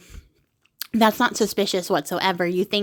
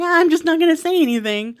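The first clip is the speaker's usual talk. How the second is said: giddy decision; 8 semitones higher, 4 dB louder than usual. Retold or imitated. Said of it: "So he's like".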